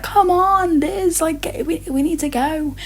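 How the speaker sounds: put-on high, urgent mom hurrying her kid; mom calling out. Mom hurrying her daughter to leave, high urgent call.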